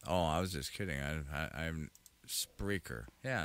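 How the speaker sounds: normal talking voice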